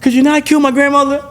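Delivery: high, pleading mock victim voice; mock plea to Dracula. Victim begging Dracula to spare grandmother, high mock plea. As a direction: play a naive victim begging a monster. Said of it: "No matter how much you appeal to Dracula".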